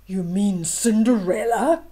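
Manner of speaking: loud, sneering stepsister voice; mocking stepsisters. Stepsisters mocking the prince's question, loud sneer. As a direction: sneer it out like a spiteful sister. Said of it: "laughed the ugly stepsisters".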